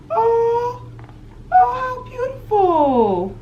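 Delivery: high, drawn-out cooing; delighted gushing. Real, not acted. Vlogger opening roommate's present, real delighted gushing. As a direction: coo slowly over it, pitch way up.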